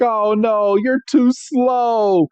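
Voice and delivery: high, slow whiny complaint; Meowth voice. Retold or imitated. Meowth griping at slow Caterpie, high and slow. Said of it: "And Meowth is like".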